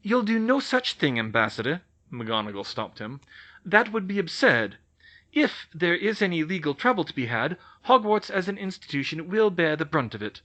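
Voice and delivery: firm older witch, lightly voiced; scolding the ambassador, lightly played; McGonagall. McGonagall stops the goblin.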